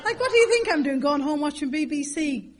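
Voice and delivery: high, loud incredulous retort; mock exasperation. Irish woman retorting to a silly question, mock exasperation. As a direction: throw it back, comically exasperated.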